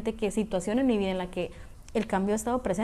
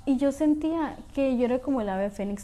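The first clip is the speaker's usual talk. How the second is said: ordinary delivery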